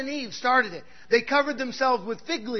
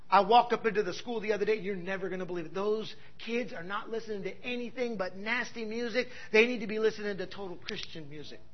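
lower, softer smug churchy voice; mocking churchgoer; 5 semitones lower, 6 dB quieter than usual. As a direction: parody the self-satisfied believer.

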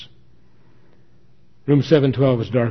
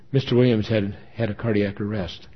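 usual speaking voice

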